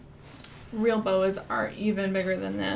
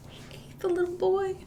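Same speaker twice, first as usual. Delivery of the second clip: mock shocked gasp; 10 semitones higher, 4 dB quieter than usual. Gasp at snake eating boy.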